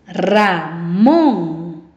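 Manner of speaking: "Ramón" in two long syllables, swelling on "món"; stress on last syllable. Shown as a demonstration. Teacher demonstrating the force of voice, long stressed "món" in "Ramón". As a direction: separate "Ra" and "món" in "Ramón", pushing the second. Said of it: "¿Escuchas la fuerza de voz?".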